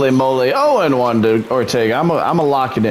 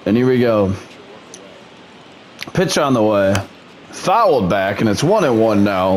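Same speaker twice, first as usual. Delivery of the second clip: plain. Acted.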